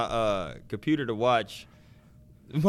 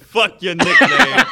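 loud, slow, raised mock defiance; mock angry outburst; 4 semitones higher, 9 dB louder, slower than usual. Friend feigning beef over a nickname, loud mock defiance. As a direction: blast it out in pretend anger.

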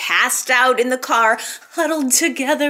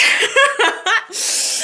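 high giggle; 10 semitones higher than usual. Real, not acted. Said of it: "laughing about makeup sex".

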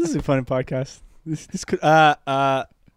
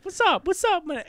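imitating Chris Tucker; 12 semitones higher than usual. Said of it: "He's like".

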